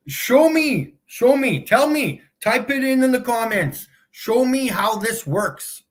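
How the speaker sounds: high, punchy challenge; insistent demand. Real, not acted. Streamer challenging viewers to type in the comments, insistent.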